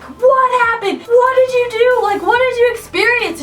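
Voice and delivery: high, anxious rapid-fire asking; urgent questions. Retold or imitated. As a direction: demand answers, keyed up. Friends grilling the scared girl.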